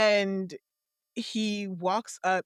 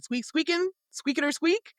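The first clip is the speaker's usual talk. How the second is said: higher mock squeaks, talking squirrel language; squirrel squeaking; 8 semitones higher than usual. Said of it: "me and this squirrel are having a conversation".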